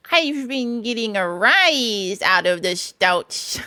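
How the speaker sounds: slow, savoured boast; pleased Mole. A narrator's reading. Mole boasting of his prank, very pleased. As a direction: relish your own cleverness. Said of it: "evidently very pleased with himself".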